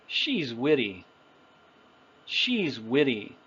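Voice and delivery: "witty" with a flapped T, like "widdy"; "she's witty" linked, T flapped; natural soft T. Shown as a demonstration. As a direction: soften the middle of "witty" to a quick D. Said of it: "But phonetically speaking".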